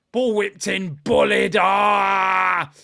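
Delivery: loud call building to a long yell; excited replay call. Real, not acted. Host describing Dusan's goal, excited shout. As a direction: build the commentary into a held shout.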